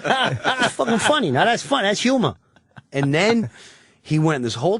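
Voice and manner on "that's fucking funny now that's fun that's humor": high, amused tough-guy approval; delighted Pesci. Retold or imitated. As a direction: cackle it like it's the best joke. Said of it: "He goes, laughs".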